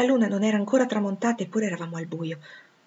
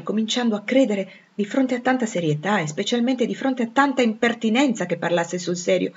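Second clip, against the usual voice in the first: ordinary delivery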